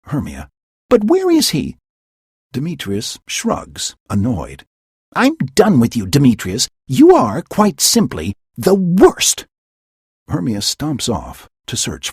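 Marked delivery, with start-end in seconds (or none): 0.9-1.8 high loud demand; angry demand. Acted. Hermia demanding Demetrius answer, angry. 5.1-9.5 high, loud furious brush-off; furious dismissal. Acted. Hermia storming away from Demetrius, furious.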